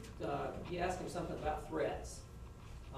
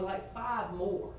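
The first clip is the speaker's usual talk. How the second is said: ordinary talk, nothing marked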